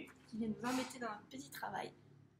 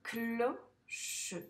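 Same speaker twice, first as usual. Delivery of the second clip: "Cloche" as clo, pause, che; syllable-by-syllable reading; 4 dB louder than usual. Shown as a demonstration. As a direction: read "Cloche" slowly in two separate syllables. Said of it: "on le lit ensemble". (« Cloche » lu en deux syllabes séparées)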